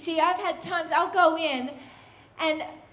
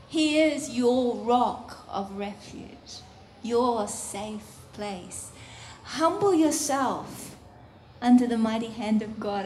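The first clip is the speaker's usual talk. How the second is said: ordinary talk, nothing marked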